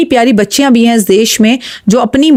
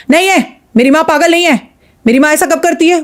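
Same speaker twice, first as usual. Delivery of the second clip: higher, heated retort of a son defending his mother; mock angry yell; 6 semitones higher than usual. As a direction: pitch it up like an offended son shouting back. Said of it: "चिल्ला चिल्ला के बोलता". (बेटे की नक़ली चिल्लाहट, ऊँची आवाज़ में)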